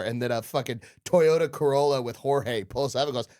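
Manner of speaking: usual speaking voice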